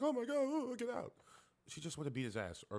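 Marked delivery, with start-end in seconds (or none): 0.0-1.1 high-pitched imitation of a frightened woman; mock scared. Woman finds stranger in apartment, mocked frightened voice. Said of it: "shook and scared like a dumb bitch".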